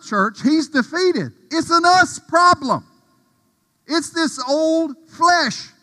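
high, loud, slow preaching climax; full-force preaching. Acted. Pastor preaching hard to the church, loud and high. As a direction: build the sermon to a roar.

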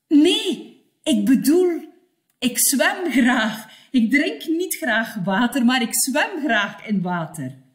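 loud insistent "Nee, ik bedoel"; insist, stressing "zwem"; emphatic correction. Acted. Learner corrects a misunderstanding. (luid nadrukkelijk verbeteren)

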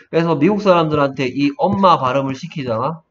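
ordinary talk, nothing marked

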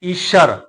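ਈਸ਼ਰ with a clear sh; sh sound. Shown as a demonstration. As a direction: say ਈਸ਼ਰ with sh, not s. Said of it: "ਸੱਸੇ ਦੇ ਪੈਰ ਦੇ ਵਿੱਚ ਬਿੰਦੀ ਸਹਿਤ".

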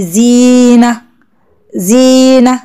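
زينة with the "ee" held long and level; long vowel in زينة. Shown as a demonstration. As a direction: stretch the ee of زينة slowly for pupils. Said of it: "فيها مد بإيه".

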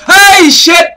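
loud high-pitched shout of fright; real jump-scare yelp; startled yell. Real, not acted. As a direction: cry out in sudden shock. Jump scare in a horror game.

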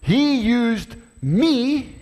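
high, slow and stretched; excited quote. Acted. Preacher acting out David's proud words, drawn out. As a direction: savour each word, lifted up. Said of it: "Next, next line".

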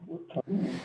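muttered under the breath; mumbling. Real, not acted. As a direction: mumble it to yourself, barely voiced. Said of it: "You, you are mumbling".

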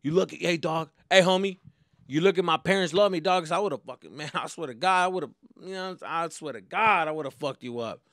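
mock hard thug voice, loud and high; a hint of a street thug; tough-guy talk. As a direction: puff up like a wannabe gangster. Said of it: "That's what I tell myself to sound hard".

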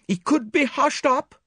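high, loud, urgent suggestion; distressed, grasping at a way out; desperate plea. A narrator's reading.

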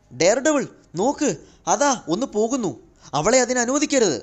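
high, loud, alarmed call; shocked cry. A narrator's reading. Jane warns Daredevil of someone getting past, shocked and loud. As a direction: call out urgently in shock, pitched right up. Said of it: "Jain ഞെട്ടലോടെ ഉറക്കെ പറഞ്ഞു".

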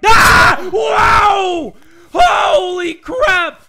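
high, loud, drawn-out shock; frightened gamer outburst; startled shout. Real, not acted. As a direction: jump at the scare and yell. Sudden enemy appears.

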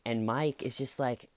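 usual speaking voice

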